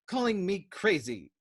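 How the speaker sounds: high, loud furious sputter; sputtering rage. A narrator's reading. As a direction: spit the words out in outrage. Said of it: "he shouted in a sputtering rage".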